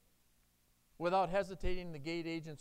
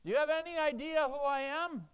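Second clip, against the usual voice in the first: loud, high, arrogant demand; proud demand; 11 semitones higher, 9 dB louder than usual. Pretend. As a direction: throw it at God as a boast. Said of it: "with God on Judgment Day".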